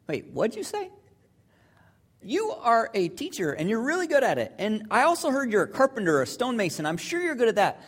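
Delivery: incredulous Simon. Acted. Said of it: "if I was Simon, I just, I'd think".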